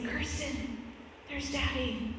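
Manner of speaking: retold murmur to a daughter; hushed pointing-out. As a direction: quietly let your little one in on it. Mother quietly pointing out Dad to child.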